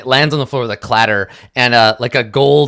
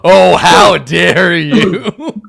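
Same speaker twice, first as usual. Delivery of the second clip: drawn-out hammed-up outrage, much slower; mock offended; 2 semitones higher, 6 dB louder, much slower than usual. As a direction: play the insulted lute player, stretched out. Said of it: "he's just like".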